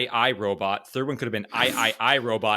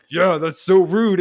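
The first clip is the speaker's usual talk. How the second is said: louder, higher, slow mock-offended echo; Gimli agreeing; 6 semitones higher, 7 dB louder, slower than usual. Gimli backing up Legolas, mock offended. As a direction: pile on the outrage. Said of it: "Gimli's like".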